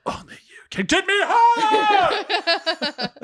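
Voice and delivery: high, slow, drawn-out whisper yell. Pretend. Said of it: "Does he whisper yell it like Jupiter ascending?".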